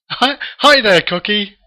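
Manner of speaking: high, loud call-out; shouted greeting. Retold or imitated. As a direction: hail someone with a hearty mock shout. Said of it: "he shouts out".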